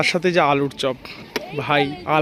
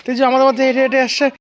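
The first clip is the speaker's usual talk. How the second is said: excited singsong; 7 semitones higher, 5 dB louder than usual. Real, not acted. Cheering child walking over.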